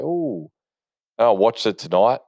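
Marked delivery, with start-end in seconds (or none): 0.0-0.5 high, soft impressed ooh, as if reading it; a soft, high gasp of awe. Retold or imitated. Reading the story, a soft impressed ooh. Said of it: "I was like".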